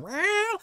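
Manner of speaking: high squeaky meow; a tiny high cat's meow; mock cat meow. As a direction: meow like a sweet little cat. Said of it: "he's like".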